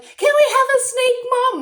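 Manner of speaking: high, loud pleading; begging kids. Retold or imitated. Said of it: "the kids are like".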